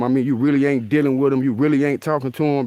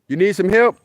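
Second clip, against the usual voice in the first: louder, higher call across the field; calling out to the deer; 7 semitones higher, 4 dB louder than usual. Real, not acted. Vlogger calls to an injured buck, raised and friendly. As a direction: shout the offer over to the buck.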